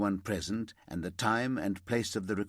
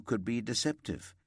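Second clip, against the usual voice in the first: ordinary delivery, nothing marked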